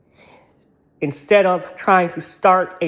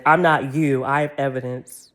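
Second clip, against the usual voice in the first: usual speaking voice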